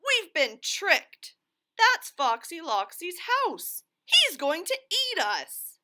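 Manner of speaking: high cartoon alarm; frightened wail. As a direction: warn friends of the trap. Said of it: "Loony Little wailed".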